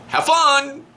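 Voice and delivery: dad shoving son out the door with a chirpy farewell; cheery send-off. Retold or imitated. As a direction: wave him off with a chirpy farewell. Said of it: "and go".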